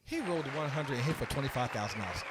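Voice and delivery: normal talking voice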